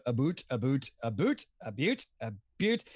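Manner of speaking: low Canadian "boot" for about; exaggerated Canadian vowel, low voice; Canadian accent. Shown as a demonstration. Impressionist practicing Canadian on request, low Canadian accent.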